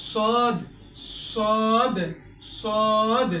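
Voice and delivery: সদ full and heavy; modelled সদ with a full mouth; heavy letter demo. Shown as a demonstration. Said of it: "এভাবে উচ্চারণ করতে হবে". (সদ মোটা করে উচ্চারণ)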